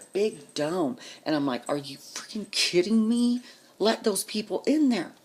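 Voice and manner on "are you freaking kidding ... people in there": retold incredulous outrage. Retold outrage at the closed dome.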